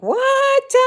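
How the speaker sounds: shrieked high what in disbelief; incredulous what. Retold or imitated. Vlogger mocks early tree-removers, shrill disbelieving what. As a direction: squeal it like it's unthinkable. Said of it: "I'm like".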